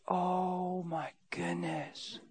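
very slow, soft disbelief; quiet, weary astonishment; hushed dismay. Retold or imitated. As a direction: let it sink out softly, drawn long. Retold dismay at the question.

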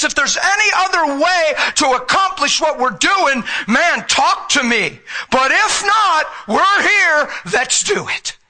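preaching at full force; fiery preaching. Real, not acted. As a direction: shout it out to the congregation.

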